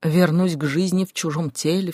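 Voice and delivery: ordinary delivery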